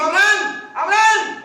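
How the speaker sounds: calling Abraham's name from heaven; angel calling out. A narrator's reading. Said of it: "a voces desde el cielo". (llamando en voz alta desde el cielo)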